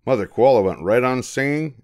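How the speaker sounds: ordinary delivery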